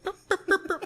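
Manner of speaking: squeaky high fart sounds in the voice; high-pitched mock farts; mock fart noises. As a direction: imitate little farts slipping out. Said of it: "little farts are slipping out".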